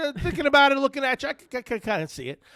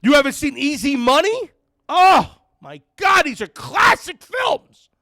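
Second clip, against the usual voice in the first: incredulous outburst; 10 semitones higher, 7 dB louder than usual. Real, not acted. Outraged at unseen classics.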